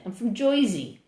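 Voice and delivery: put-on New York-style Jersey accent, "Joizy"; mock Jersey accent. Shown as a demonstration. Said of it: "We actually don't talk that way. New Yorkers talk that way".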